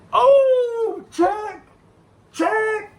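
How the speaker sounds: very high, loud whooping cry; bust-out hilarity. Retold or imitated. Coworker cracking up at the comeback, shrieking whoop. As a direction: imitate a coworker howling the name in delight. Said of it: "Kyle just bust out laughing".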